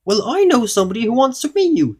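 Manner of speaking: very high, loud goblin question; little goblin voice. A narrator's reading. Goblin asking Mister Uppity for a hello, little high voice. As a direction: squeak it out like a tiny goblin. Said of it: "said the goblin".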